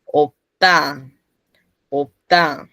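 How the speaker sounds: opta with a closed P into a hard T; tense T. Shown as a demonstration. Teacher modelling P before T, opta with tense T. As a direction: shut the P, then press the T in opta. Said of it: "se pronuncia tensa".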